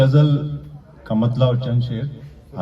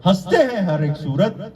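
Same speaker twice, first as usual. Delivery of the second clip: measured declamation with pauses between phrases; mushaira-style verse recitation; poetry recital; 6 semitones higher, 4 dB louder than usual. Acted. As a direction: recite the couplet to an audience, landing each phrase. Recites ghazal couplets.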